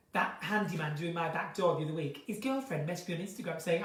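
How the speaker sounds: normal talking voice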